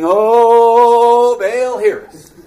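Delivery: loud, high tent-revival wail; revival cry. Acted. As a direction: call out to Baal like a camp meeting. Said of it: "like a tent revival".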